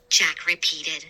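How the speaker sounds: ordinary delivery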